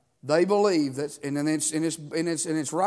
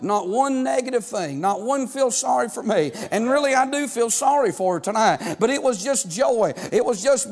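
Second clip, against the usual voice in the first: higher and louder, rolling preacher's cadence; full-force preaching; 6 semitones higher, 6 dB louder than usual. Acted. Pastor urging the congregation at full force, preaching.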